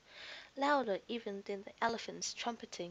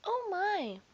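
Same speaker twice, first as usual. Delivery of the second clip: high startled gasp; shaken oh my; 11 semitones higher, 5 dB louder than usual. A narrator's reading. Faetua shaken after the elephant's blast, high startled. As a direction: knees still knocking.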